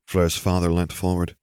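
ordinary delivery, nothing marked

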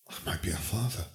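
very soft, quick words; hushed realization. A narrator's reading. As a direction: say it barely aloud, to yourself. Harry absorbs the shocking thought.

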